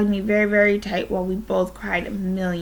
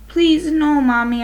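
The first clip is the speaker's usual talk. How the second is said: pleading cry, higher and louder; raised, urgent child's plea; 6 semitones higher, 6 dB louder than usual. A narrator's reading. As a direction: cry out in protest to a parent. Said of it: "I cried".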